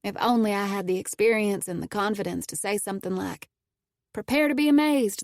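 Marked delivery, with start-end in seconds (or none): 4.1-5.2 bold, louder and higher; confident. Pretend. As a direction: deliver it with swagger. Imagined confident retort.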